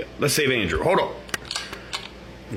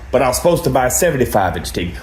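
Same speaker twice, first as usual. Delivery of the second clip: ordinary delivery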